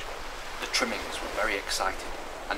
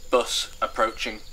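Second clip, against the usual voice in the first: slow, louder automated notice; measured device voice; machine announcement; 5 dB louder than usual. Acted. Television set announces the bus.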